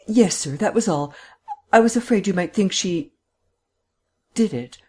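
anxious-to-agree woman servant; eager housekeeper. A narrator's reading. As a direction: seize on the excuse, pitch lifted. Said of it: "She grasped eagerly at his words".

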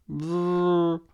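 put-on a high childish brrr noise; mock brrr. A narrator's reading. Tobi making a disgusted brrr at his food. Boy pulling a face.

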